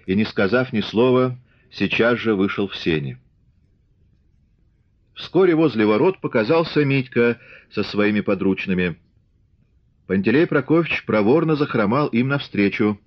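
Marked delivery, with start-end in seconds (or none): none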